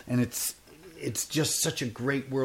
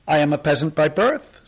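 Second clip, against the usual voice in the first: put-on raised, earnest old tramp telling his tale; the tramp's voice; 6 semitones higher, 11 dB louder than usual. Tramp sighing to the soldiers about his birth, raised and earnest. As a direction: plead your humble story like a pious vagabond.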